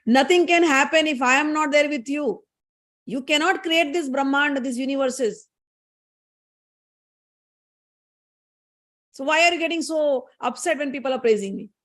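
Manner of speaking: acted scolding, pitch slightly up; quarrelling wife. Goddess fighting with her husband, mildly angry. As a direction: lay down the law in mock anger. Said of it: "they both started fighting".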